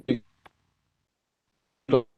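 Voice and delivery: ordinary talk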